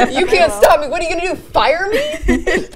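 high, loud playful taunt breaking into laughter; mock defiance. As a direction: dare them, laughing. Joking defiance at the boss.

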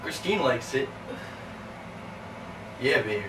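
very low, soft come-on; hushed, deep sweet-talk; sleazy flirting. Acted. Man flirting with the guest.

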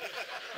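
bursting into a high laugh. Real, not acted. Laughing at his joke about Jerry's sermons.

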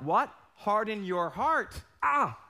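usual speaking voice

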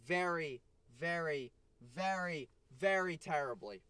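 each "very" hammered with a falling tune; repeated emphasis. Real, not acted. Scolding viewers for wasted potential, hammering each "very". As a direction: lean hard on every "very" before "terribly". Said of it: "I say that with great emphasis".

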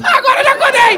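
loud, high furious yell; mock outraged shout; angry shout. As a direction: snap it back, fuming.

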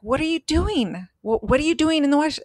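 high, startled asking; alarmed question. Retold or imitated. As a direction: call out in alarm at her. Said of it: "And I'm like".

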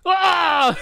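sudden high yell; battle roar. Real, not acted. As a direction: roar out in the heat of play. Reacting to the volleyball play.

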